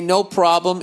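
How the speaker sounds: bright, polite service voice; a hint of a cheerful store clerk; customer service voice. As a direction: sound eager to help a customer. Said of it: "in my best customer service voice".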